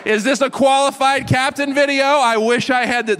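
hammed-up mocking disbelief; mock outrage. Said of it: "Instagram handles for people who make silly mistakes".

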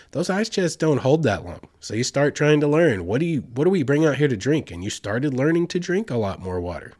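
normal talking voice

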